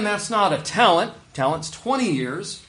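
ordinary talk, nothing marked

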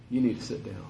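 low, soft, deadly calm order; a father's hushed warning; quiet stern command. Retold or imitated. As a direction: say it quietly so it lands like a threat. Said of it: "I'm telling you".

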